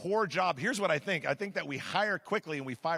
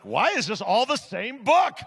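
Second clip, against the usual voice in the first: mock loud question; 7 semitones higher, 9 dB louder than usual. Mock exchange over the book.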